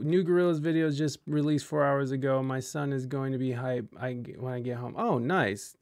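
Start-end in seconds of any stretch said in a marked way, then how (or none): none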